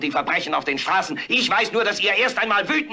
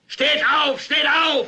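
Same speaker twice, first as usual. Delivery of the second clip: shouted command; 8 semitones higher, 4 dB louder, much slower than usual. Acted. Orator commanding the audience.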